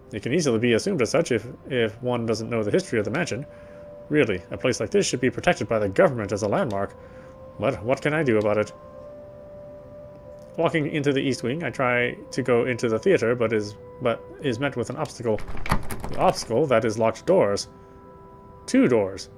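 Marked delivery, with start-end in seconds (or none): none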